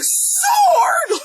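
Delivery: very high, drawn-out yell; high hammed-up movie shout; battle-cry shout.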